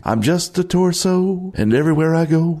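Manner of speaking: high singsong ghost voice; ghostly voice. Acted. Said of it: "you hear a ghostly voice behind you saying".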